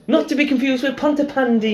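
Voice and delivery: high, singsong mock Welsh; exaggerated Welsh accent.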